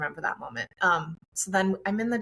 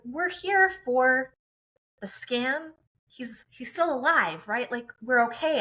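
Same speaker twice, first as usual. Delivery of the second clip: higher, slow, disbelieving plea; anxious pleading; 6 semitones higher, slower than usual. Retold or imitated. Mother pleading with the counselor that the baby is alive, anxious higher plea. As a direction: beg her to say the baby is fine.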